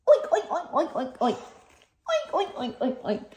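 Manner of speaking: playful high oinking; laughing pig noises, high and playful; pig oinks. Pretend. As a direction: oink like a pig for the baby.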